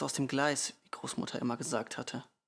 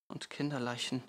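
ordinary delivery

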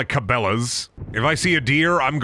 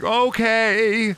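mock chipper okay; 9 semitones higher than usual. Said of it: "And I'd be like".

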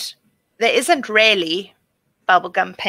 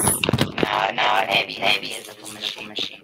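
much lower, fast robotic glitching; 11 semitones lower than usual. Real, not acted. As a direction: voice chopped up like a machine. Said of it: "Now we can't hear you at all".

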